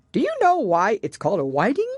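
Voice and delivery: loud, eager Gryphon voice. A narrator's reading.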